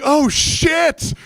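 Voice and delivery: high retold cry of dismay; alarmed exclamation. Storyteller reliving dropping his phone in a waterfall, alarmed.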